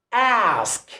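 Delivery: "ask" with the flat American A, as in cat; American A sound. Shown as a demonstration. Teacher introducing the A sound to learners, American "ask". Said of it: "difference between the American accent and the British accent".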